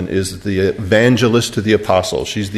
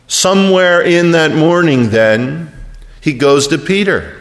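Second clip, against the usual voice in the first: higher, pressing sermon build; impassioned preaching; 9 semitones higher, 4 dB louder than usual. Acted. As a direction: drive it home from the pulpit. Preaching at force.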